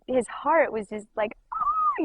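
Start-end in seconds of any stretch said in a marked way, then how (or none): 1.5-2.0 very high-pitched delighted "oh"; acted-out thrilled little squeal; excited squeal. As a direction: squeal as if the heart bursts with joy. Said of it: "just so excited".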